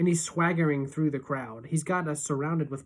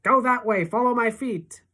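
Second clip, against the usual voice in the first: urgent directing; 7 semitones higher, 6 dB louder than usual. A narrator's reading. Steering friend through crowd.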